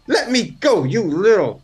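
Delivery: high-pitched indignant monkey voice; angry monkey. Acted. Monkey telepathically to the player who caught him, angry. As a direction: plead crossly as a captured little monkey. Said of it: "he's very angry".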